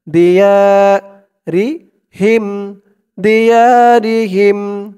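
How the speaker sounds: slow chanted tajweed reading; Quran recitation. Acted. Teacher reciting the verse for students to follow. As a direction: draw out فالذين هاجروا melodically.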